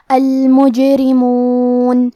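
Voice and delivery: المجرمون very slowly, the jim bounced; qalqalah on jim. Shown as a demonstration. Teacher demonstrating qalqalah to students, very slow. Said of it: "ہم یہ حرف کو ہلاتے ہوئے یہ کلمہ کو پڑھیں گے".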